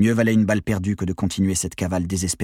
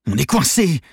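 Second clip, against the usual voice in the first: high, loud agitated cry; worked-up; 8 semitones higher, 5 dB louder than usual. A narrator's reading. Trapped accomplice to gang leader, worked-up cry. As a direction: lose your nerve, pitched up. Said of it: "s'énerva Maxime Prieur".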